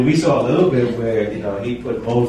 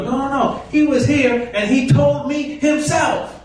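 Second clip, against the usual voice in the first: insistent protest; 14 semitones higher than usual. Retold or imitated. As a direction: protest that he told you himself. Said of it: "and you go".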